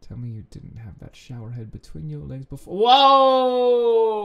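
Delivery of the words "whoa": long, loud, high whoa; drawn-out yell of disbelief; shocked shout. Real, not acted. Shocked reaction to the line read.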